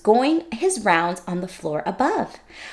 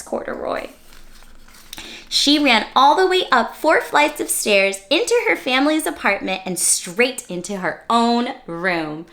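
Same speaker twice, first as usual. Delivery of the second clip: ordinary delivery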